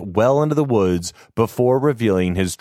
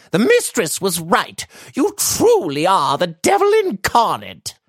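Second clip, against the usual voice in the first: much higher, loud accusing voice; outraged old maid; 13 semitones higher, 3 dB louder than usual. Acted. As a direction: denounce him as the devil. Maid condemns master.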